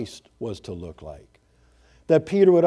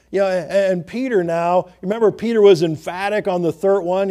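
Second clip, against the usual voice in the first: ordinary talk, nothing marked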